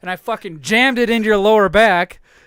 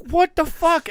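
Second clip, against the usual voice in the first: mock outrage; 9 semitones higher, slower than usual. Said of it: "I was like".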